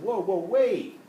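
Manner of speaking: high, loud urgent whoa, halting the crowd; urgent call to stop. Retold or imitated. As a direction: call them back before they walk off. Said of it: "Jesus kind of said".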